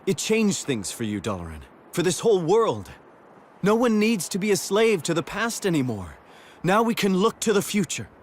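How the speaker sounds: rally him toward the future; rising hope. Acted. Friend urges the grieving king to look ahead, with rising hope.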